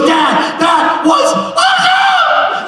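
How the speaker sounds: very high, loud, slow sung-out squeal; loud high singsong shriek; thrilled squeal. Retold or imitated. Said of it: "she runs up to me and she goes".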